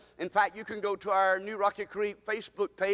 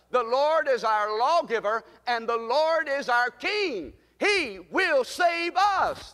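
forceful, drawn-out call and repeat; rally the church with each declaration; preaching at full force; 3 semitones higher, 6 dB louder, slower than usual. Real, not acted. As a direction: build to a high, slow, triumphant last line. Leads congregation, building to climax.

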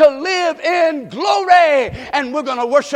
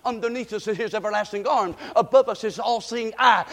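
usual speaking voice

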